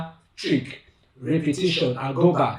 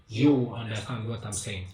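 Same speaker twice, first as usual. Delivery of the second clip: usual speaking voice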